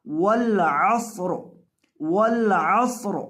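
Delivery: वल असर recited slowly, stopping on a silent final र; slow Quranic recitation. Shown as a demonstration. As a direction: drawl वल असर out and end it on a still र. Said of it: "वक़फ़ करते वक़्त हम इस लफ्ज़ पर पढ़ेंगे". (धीमी तिलावत, आख़िरी र साकिन)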